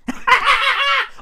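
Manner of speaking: short high laugh; amused laugh. Real, not acted. Telling viewers about mom's phone, amused laugh. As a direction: laugh at your own joke.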